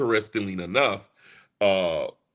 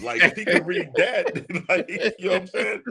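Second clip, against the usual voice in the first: laughing; 8 semitones higher than usual. Real, not acted. Laughing about dad.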